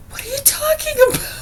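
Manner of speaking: very high, fast mock mom voice; mimicking Mom. Imitating Mom's confusion, high and fast. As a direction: squeak it like a baffled mom. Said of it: "She's like".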